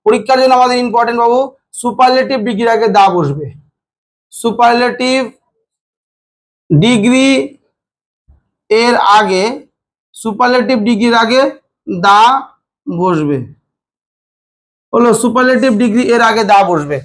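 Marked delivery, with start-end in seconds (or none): 4.3-13.4 chunk by chunk, long pauses between phrases; dictation pace. Shown as a demonstration. Teacher dictates a grammar rule to students, slow paused dictation. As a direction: dictate it piece by piece for note-taking.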